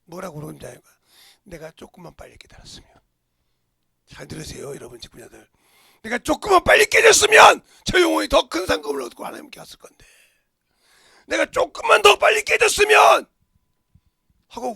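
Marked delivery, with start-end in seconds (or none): 1.4-2.9 hushed, breathy lament; whispered regret. Retold or imitated. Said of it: "엄청나게 울었다는 거예요". 6.0-13.2 loud, high, anguished wail; wailing lament. Retold or imitated. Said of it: "대성통곡하고 울었다는 거예요".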